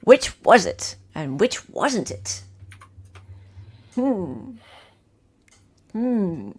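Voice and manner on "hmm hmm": ordinary talk, nothing marked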